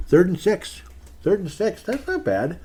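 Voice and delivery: high, surprised repeat of the down; rising surprised call. Real, not acted. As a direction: pleasantly caught off guard. Surprised at the field situation.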